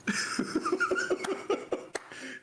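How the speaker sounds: long, high wheezing laugh; laughter. Real, not acted. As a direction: crack up until breathless. Cracks up at the punchline.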